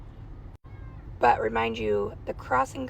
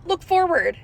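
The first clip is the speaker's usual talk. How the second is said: curt duty teacher; 7 semitones higher, 5 dB louder than usual. Retold or imitated. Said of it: "she's like".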